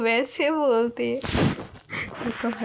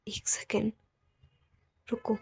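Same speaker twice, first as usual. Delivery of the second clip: whisper; 7 dB quieter than usual. Acted. Alpha pauses to herself.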